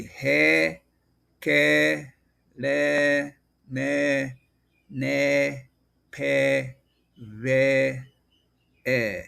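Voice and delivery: "he, ke, le, me, ne, pe, ve, e" with long "eh" vowels, set apart; syllable demonstration. Shown as a demonstration. Teacher sounding out the vowel E, drawn-out separate syllables. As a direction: hold each syllable of "he, ke, le" evenly. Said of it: "known as".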